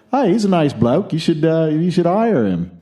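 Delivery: high, hammed-up Aussie bloke; butchered Australian accent.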